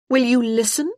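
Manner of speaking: a sharp challenge to her brother; young sister demanding to be heard, sharply. Acted. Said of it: "she asked him sharply".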